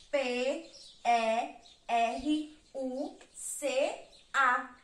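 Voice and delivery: slow, letter-by-letter spelling; one letter at a time for a class. Shown as a demonstration. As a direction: spell it out slowly, pausing between letters. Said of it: "Quais são as letras da palavra peruca?".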